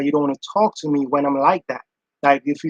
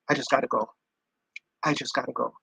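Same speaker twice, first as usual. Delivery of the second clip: calm exit; 5 semitones lower, 9 dB quieter, faster than usual. Retold or imitated. Voicing Johnny leaving calmly.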